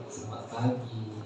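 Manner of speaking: dropped low and soft, a dig at someone late; pointed greeting. Shown as a demonstration. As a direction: greet the latecomer with quiet sarcasm. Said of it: "terus gaya kita berubah".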